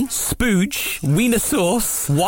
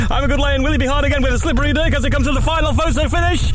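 breakneck horse-racing commentary, rising steadily in pitch; Peter O'Sullivan; 4 dB louder, much faster than usual. Acted. Imagining race commentary on rude horse names, rapid commentator impression. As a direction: rattle it off in one unbroken race-caller chant. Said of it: "the old Peter O'Sullivan on the racehorsing commentary".

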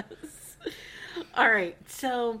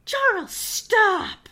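high, loud, slow cry of his name; mock pleading yell; 9 semitones higher, 6 dB louder, much slower than usual. Ride or Die pleading with Charles, slow high cry. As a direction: drag out the plea to stop. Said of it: "he's like".

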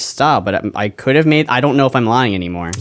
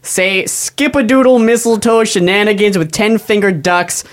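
mad scientist; 8 semitones higher than usual. Shown as a demonstration. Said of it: "I just wanna hear you do it".

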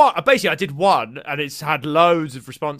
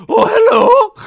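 much higher, louder falsetto granny; Big Mama impression; 12 dB louder, slower than usual. Comedian imitating Martin Lawrence as Big Mama. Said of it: "Martin Lawrence plays Big Mama".

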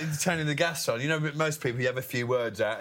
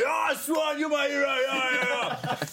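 mock crowd cheer; 13 semitones higher, slower than usual. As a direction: cheer him on like a crowd hero.

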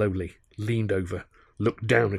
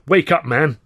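loud, high shout to wake him; calling out; 6 semitones higher, 8 dB louder than usual. Acted. Narrator shouting at drunken Charlie to wake up, high and loud.

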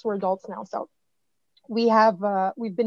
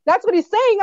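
high, loud sudden realization; mother working it out, excited; 8 semitones higher, 8 dB louder than usual. Retold or imitated. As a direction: let it dawn on you, louder. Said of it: "'cause I'm like".

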